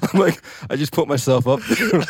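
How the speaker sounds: words tumbling out over a loud laugh. Real, not acted. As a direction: crack up while saying it. Cracking up at own remark.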